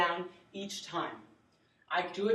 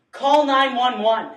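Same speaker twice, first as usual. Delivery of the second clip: slow, raised order; loud command; 5 semitones higher, 15 dB louder, slower than usual. Acted. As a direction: call out the order firmly. Rescuer ordering a bystander.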